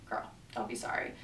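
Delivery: hushed, unvoiced reassurance; mock-whispered don't be sorry. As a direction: whisper it kindly to the cashier. Reassuring a cashier.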